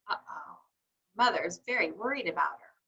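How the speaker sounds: soft, hushed uh-oh; worried whisper. Acted. Teacher softly telling the class Mother is worried. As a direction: hush it like a secret worry.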